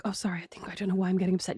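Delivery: soft, breathless fake tearfulness; mock choked-up whisper. Retelling her fake tears to her friend, whispered and choked. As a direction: pretend to choke up, half whispering. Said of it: "I even start choking up for dramatic effect".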